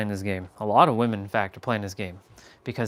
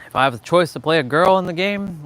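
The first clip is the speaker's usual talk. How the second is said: louder and higher, insisting on it; emphatic repeat; 5 semitones higher, 7 dB louder than usual. Shown as a demonstration. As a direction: declare it like a firm conviction.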